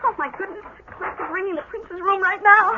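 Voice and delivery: hushed excitement. Acted. Hushed aside during phone call.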